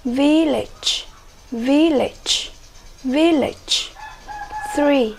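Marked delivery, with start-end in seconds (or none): 0.0-3.9 "village" stressed on its first syllable each time; repeated teacher model of VIL-lage. Shown as a demonstration. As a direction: hit VIL in "village", ending lighter. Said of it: "chúng ta sẽ nhấn là".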